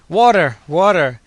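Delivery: high, loud desperate cry; heart-rending cry. A narrator's reading. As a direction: beg for water with a cry. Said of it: "heard a heart-rending cry".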